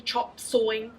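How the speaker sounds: usual speaking voice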